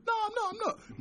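high, squeaky denial; indignant kid. Retold or imitated. Retelling a playground taunt, high indignant denial. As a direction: deny it like a wound-up child.

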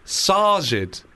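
Sajid as SAH-jid, drawn out; long "ah" pronunciation. Shown as a demonstration. Host demonstrates the name to co-hosts, Sajid as SAH-jid. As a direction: stretch Sajid slowly with an open "ah". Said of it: "So I think that's, like".

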